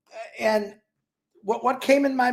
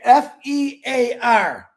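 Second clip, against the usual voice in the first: usual speaking voice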